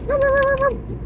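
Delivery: high, loud yappy little-dog woofs; little dog barking. Shown as a demonstration. Owner imitates a little dog for pet bird, high loud yapping. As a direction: yap like an excited small dog. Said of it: "A little dog goes".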